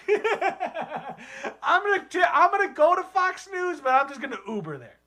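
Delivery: high, loud mock proclamation, then settling; hipster country guy. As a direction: boast like a country hipster, half laughing. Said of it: "type of guy like that".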